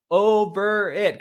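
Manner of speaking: "Over it" very slow, loud and high; emphatic. Shown as a demonstration. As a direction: drag out "Over it" like a final verdict. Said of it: "Capital O V E R I T exclamation point".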